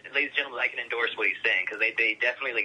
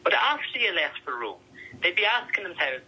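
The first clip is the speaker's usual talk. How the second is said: lightly voiced Irish cousin, higher and amused; cousin's Irish accent; 5 semitones higher than usual. Cousin Eamon teases him about his accent, in a light Irish lilt. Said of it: "my cousin Eamon looks at me like".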